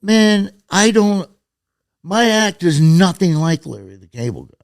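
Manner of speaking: retold exasperated protest, high and loud; worked-up objection, replayed for laughs; mad. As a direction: complain to your buddy, voice pushed up. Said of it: "I was mad".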